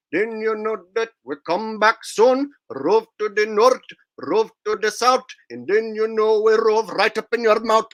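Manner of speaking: singing "Land on the Moon" in a mock Indian accent; Indian accent song. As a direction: chant it playfully in an Indian accent. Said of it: "sing the Indian accent, Land on the Moon".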